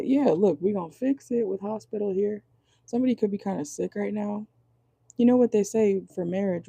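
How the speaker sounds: ordinary delivery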